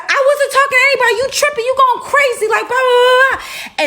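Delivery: very high, rapid-fire mock yelling; hammed-up indignant protest. Girl arguing back at her accuser, high hammed-up outrage. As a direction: rattle off a squealed denial, imitating her.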